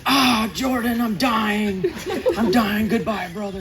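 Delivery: high, hammed-up dying kid with laughter; mock dying. Boy fakes dying to his little brother, hammed-up and high. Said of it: "So I fell down and said".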